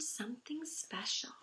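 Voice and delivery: hushed storybook whisper; a secret whispered to the children. A narrator's reading. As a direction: breathe it like a secret. Suspenseful turn in read-aloud story.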